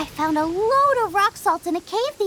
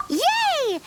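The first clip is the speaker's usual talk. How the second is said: higher bright yay; delighted cheer; 8 semitones higher than usual. Acted. Xiangling cheering at Paimon, delighted. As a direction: celebrate the win.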